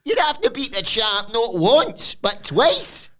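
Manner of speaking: mock exasperated explaining. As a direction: explain it high and fed up. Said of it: "Noam's like".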